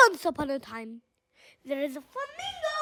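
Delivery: hushed opening. Real, not acted.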